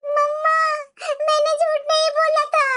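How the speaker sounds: high-pitched child crying to mom, acted; tearful child. Accused child tells mother she didn't lie, tearful. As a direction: protest innocence in a tearful little-kid voice. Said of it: "तू रो मत".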